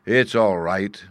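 plain reading voice

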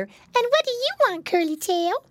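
put-on high-pitched little boy asking; squeaky, curious piglet voice; piggy boy. A narrator's reading. Piglet asking brother's Christmas wish, high curious voice.

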